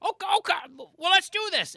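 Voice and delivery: high, loud, eager dad impression; suddenly won over, raring to go; imitating a dad getting keen; excited dad. Retold dad won over by hunting.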